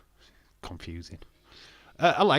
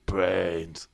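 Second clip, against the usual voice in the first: zombie voice; 5 semitones lower, 4 dB louder than usual. Pretend. Joke about not doing zombies.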